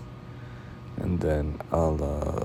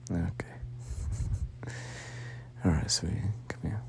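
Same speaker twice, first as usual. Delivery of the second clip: very quiet, near-whispered comfort; hushed, tender whispering; soft intimate murmur; 6 semitones higher, 12 dB quieter than usual. Acted. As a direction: speak softly to a partner cuddled up close. Soft cuddling scene with partner.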